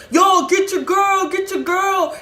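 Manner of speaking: loud, high shouted plea; drawn-out high shout for backup; panicked calling out. Retold or imitated. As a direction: yell for help while being chased. Man calls out while being chased.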